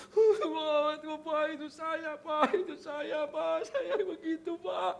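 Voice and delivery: high, drawn-out mock wailing; crying like a child. Driver confesses to preacher that's him, childlike crying. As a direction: imitate a grown man bawling. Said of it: "Dia malah nangis kayak anak kecil".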